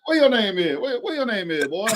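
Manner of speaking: hammy tipsy uncle at a cookout; barbecue uncle voice. Pretend. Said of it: "I sound like somebody uncle at the barbecue".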